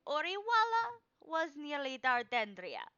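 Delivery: put-on high, loud old woman proclaiming; old crone seer. Crone reading Shadow's card, loud and high. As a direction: cry out each card like a mystic crone.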